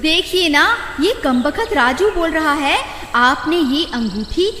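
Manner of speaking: high woman's voice, telling tales; complaining wife. Acted. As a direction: whine to her husband about the accusation.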